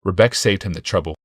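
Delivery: plain reading voice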